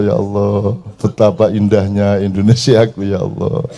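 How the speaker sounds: a lightly hammed-up devotee sighing over Indonesia; mock pious rapture.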